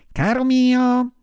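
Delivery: high, loud, drawn-out endearment; high-pitched animal comforter; consoling marmot. A narrator's reading. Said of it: "replicò la marmottina per consolarlo".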